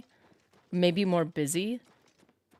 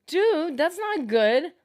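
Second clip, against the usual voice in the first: high, loud alarmed reaction; dismayed; 9 semitones higher, 5 dB louder, slower than usual. Real, not acted. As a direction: sound genuinely alarmed. Reacting to bad news.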